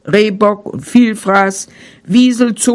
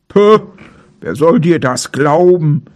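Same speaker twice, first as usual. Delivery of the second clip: scornful bear; 4 semitones lower than usual. A narrator's reading. Bear dismissing Maral's tale of the dam, mild scorn. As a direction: scoff at the deer as the bear. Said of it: "rief der Bär".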